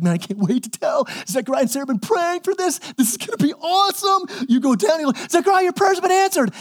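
can't wait to tell, shouting the news; hammed-up thrilled angel; excited Gabriel.

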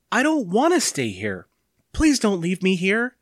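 put-on high-pitched pleading child's voice; pleading boy. A narrator's reading. Boy begs mother not to leave.

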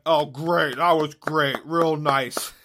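pointedly parroting the compliment; retold sarcastic praise. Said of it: "I went".

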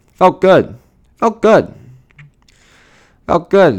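gleeful. Real, not acted. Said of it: "gloating about the incident".

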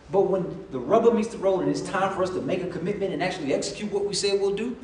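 ordinary talk

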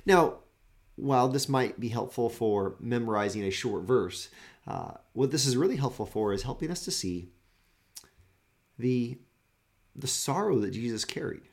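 ordinary talk

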